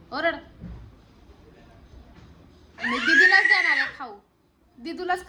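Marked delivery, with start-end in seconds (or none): 2.8-5.3 loud, higher, playful threat; teasing shout. Real, not acted. Mother teasing her son that the snack goes to his sister, loud playful shout. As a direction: call it out to tease the little one.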